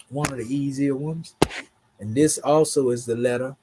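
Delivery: ordinary delivery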